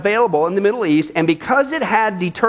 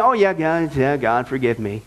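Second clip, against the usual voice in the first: flippant prayer; 7 semitones lower than usual. Pretend. Careless sinner brushing off forgiveness, low and offhand. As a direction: toss it off like it's nothing. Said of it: "David isn't interested".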